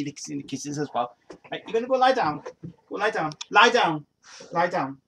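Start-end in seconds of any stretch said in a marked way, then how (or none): 1.5-5.0 higher, louder firm dog command. Real, not acted. Owner firmly orders his excited dog to lie down.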